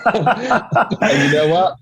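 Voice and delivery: slow, drawn out through a laugh. Real, not acted. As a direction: stretch it out while still laughing. Laughing reply to friend.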